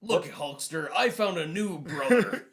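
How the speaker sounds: loud gravelly-free wrestler rasp, laughing; Macho Man impression. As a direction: growl it like a wrestler cutting a promo. Voicing Macho Man to Hulk.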